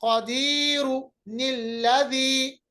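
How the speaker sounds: कदीरु निल्लदी read very slowly with the linking nun; slow joined recitation. Shown as a demonstration. Teacher slowly reciting the example कदीरु निल्लदी again for students. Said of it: "दूसरे हरकत को नून जेर नी बना लेते".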